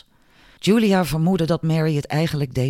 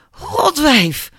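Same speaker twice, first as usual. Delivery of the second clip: high, loud angry outcry; pained cry; 11 semitones higher, 5 dB louder than usual. A narrator's reading. Albert, just struck by Julia, high angry cry. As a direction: cry out the insult in pained outrage. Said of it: "riep hij en greep naar zijn hoofd".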